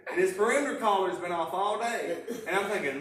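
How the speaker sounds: usual speaking voice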